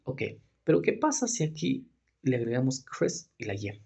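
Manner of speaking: normal talking voice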